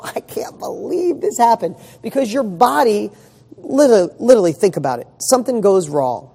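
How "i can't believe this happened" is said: laughing it off. Pretend. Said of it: "what if you just go".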